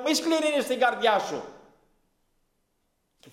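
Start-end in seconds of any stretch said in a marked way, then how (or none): 0.0-1.4 loud, high, full-force preaching cry; shouting loudly. Retold or imitated. Preacher shouting warnings to the crowd in the park. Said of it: "Άρχισα να φωνάζω δυνατά".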